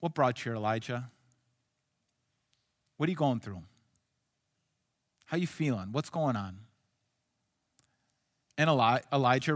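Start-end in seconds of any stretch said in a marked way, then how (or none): 0.0-6.6 gentle, caring. Pretend. God gently checking on a hurting Elijah, tender and soft. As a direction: lower and softer, tender questions. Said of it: "I think what he's saying is".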